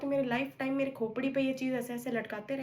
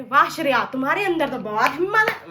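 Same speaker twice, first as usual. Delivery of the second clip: mock-sarcastic mum; 10 dB louder than usual. Mum on video call sarcastically praises daughter's nerve, loud mock praise. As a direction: belt it out as a mum mocking her daughter's daring.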